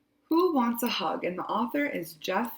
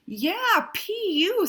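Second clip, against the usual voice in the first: high, loud mocking raccoon; raccoon's jeer; 11 semitones higher, 3 dB louder than usual. Raccoon jeering at Skunk's smell, high and loud. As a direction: jeer at the stink.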